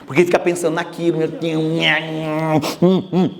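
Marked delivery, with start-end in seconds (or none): 1.2-3.3 low, muttered nha nha of someone stewing; mock brooding grumble. Speaker mimics someone stewing in anger, low mock grumbling. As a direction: imitate a person chewing over a grudge. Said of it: "Bem assim".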